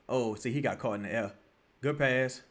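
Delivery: usual speaking voice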